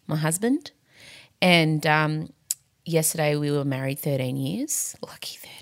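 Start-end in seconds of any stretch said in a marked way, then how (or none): 5.1-5.6 breathy whispered joke; whispered aside. Real, not acted. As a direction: whisper it under your breath. Aside about wedding anniversary.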